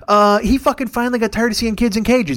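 usual speaking voice

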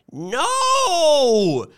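high, drawn-out wail of "no"; high wail of protest; anguished cry. A narrator's reading. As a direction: cry out in dread. Dreading bad news ahead of time.

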